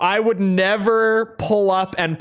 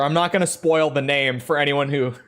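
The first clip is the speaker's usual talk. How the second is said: ordinary delivery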